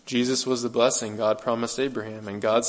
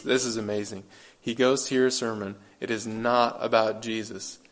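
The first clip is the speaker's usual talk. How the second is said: normal talking voice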